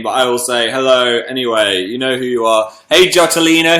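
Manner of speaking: usual speaking voice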